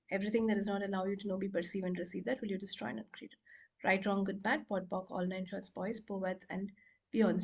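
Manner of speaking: low-key, quick rote recital; soft, practised rote formula; clearing statement recitation. Real, not acted.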